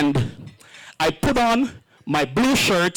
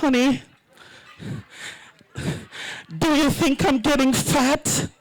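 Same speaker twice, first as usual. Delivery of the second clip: high, pleading wife voice; husband mimics his wife, high and anxious; wife asking; 9 semitones higher than usual. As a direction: play the anxious pregnant wife, pitched up. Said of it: "and she says".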